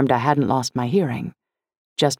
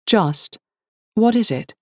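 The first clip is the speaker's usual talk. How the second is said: higher, put-on English voice; busy upper-crust English, pitched up; aristocratic English accent; 7 semitones higher, slower than usual. A narrator's reading. Liz keeps typing as daughter interrupts, aristocratic English accent. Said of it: "manufactured aristocratic English accent".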